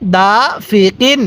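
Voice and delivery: slow, syllable by syllable হাসিদিন; slow recitation. Shown as a demonstration. Teacher reading the word slowly for students to copy. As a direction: draw each syllable out carefully. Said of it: "আরেকবার".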